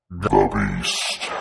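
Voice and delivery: very low growled beast voice; monster voice. Acted. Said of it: "That was awesome".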